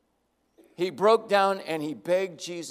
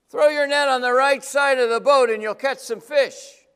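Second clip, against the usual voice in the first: loud call pitched higher across the water; shouting from shore; 6 semitones higher, 8 dB louder than usual. Acted. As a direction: yell to a boat out on the lake. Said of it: "somebody on shore yells out".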